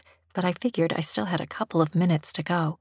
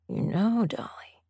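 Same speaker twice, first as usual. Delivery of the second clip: thoughtful; 5 semitones higher than usual. A narrator's reading. Master musing to his kneeling dolly, thoughtful. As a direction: muse on it, trailing into softness. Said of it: "he said thoughtfully".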